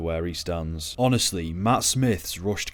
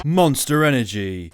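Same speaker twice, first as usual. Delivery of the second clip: loud, higher announcer-style shout; mock product plug; 5 semitones higher, 8 dB louder than usual. Reviewer spoofing product placement in the film, loud plug. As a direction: punch it out like a brand plug.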